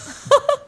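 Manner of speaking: high squealing laugh; real laughter. Real, not acted.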